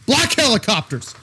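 lightly hammed-up conspiracy cry; Alex Jones rant. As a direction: call it out like a paranoid crank. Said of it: "start screaming like Alex Jones".

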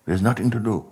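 ordinary delivery

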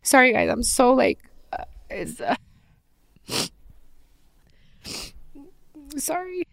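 fighting back tears, barely getting words out; choked up crying. Real, not acted. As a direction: voice breaking into tears, then a high strained sob on sorry. Breaks down mid-video, apologizing.

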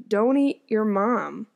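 usual speaking voice

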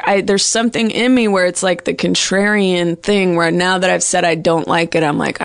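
ordinary talk

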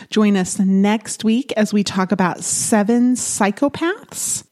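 upbeat host outro read; podcast outro. Acted. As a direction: sign off like a radio host.

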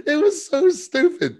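high, loud, slow words through laughter; laughing hard. Real, not acted. Podcaster cracked up at a silly line, real helpless laughter. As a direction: barely get it out for laughing.

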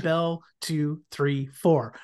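quick, even count of the rings; brisk bell count. Retold or imitated. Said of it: "could you slow it down?".

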